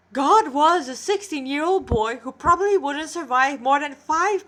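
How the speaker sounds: awed narration. A narrator's reading. Narrator marveling at the boy God, awed reading. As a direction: read it with rising wonder.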